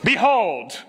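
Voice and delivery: mock-formal herald voice at home; lightly hammed-up herald; grand announcement. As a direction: announce a son entering the room like royalty. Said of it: "Do you use that word very often?".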